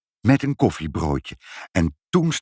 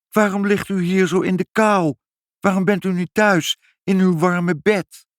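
high-pitched, worried questioning; concerned mother; 12 semitones higher, 4 dB louder than usual. A narrator's reading. Mother asks the old man why he's out, high and concerned. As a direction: fuss over him in a high voice. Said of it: "zei moeder".

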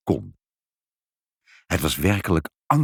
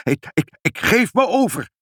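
breathless, high stammered surrender; panting; 13 semitones higher, 3 dB louder than usual. A narrator's reading. Pinkepank surrendering to mother, panting high voice. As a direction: stumble on the first word, then cry high. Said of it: "riep meneer Pinkepank hijgend".

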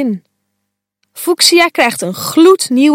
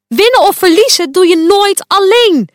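loud, higher stern lecture, WINNEN hit hard; boze les; 9 semitones higher, 4 dB louder than usual. A narrator's reading. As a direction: hammer the lesson home angrily. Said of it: "roept Quark". (luid en streng, nadruk op winnen)

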